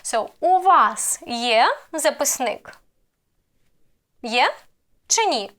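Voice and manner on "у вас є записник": slow question with є rising sharply; deliberate question peaking on є; question intonation. Shown as a demonstration. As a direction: lift є, then let записник fall. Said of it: "I will raise intonation on the verb".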